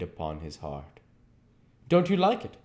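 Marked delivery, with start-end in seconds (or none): 1.9-2.6 high hurt cry of a question; rising pained question; cried out. A narrator's reading. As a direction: cry it out, stung by the silence. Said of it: "cried Hallward at last".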